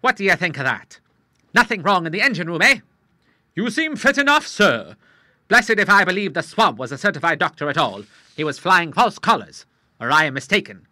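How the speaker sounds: put-on an old salt spoiling for a challenge; lightly voiced hearty old Admiral; old sea captain, eager. Admiral after the run.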